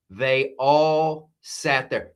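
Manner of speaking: very slow, each word weighted; slow emphasis. Real, not acted. Comedian describing lawmakers staying seated, dragged out slowly. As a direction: let the silence of the room land.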